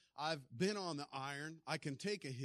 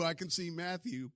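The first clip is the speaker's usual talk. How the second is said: ordinary talk, nothing marked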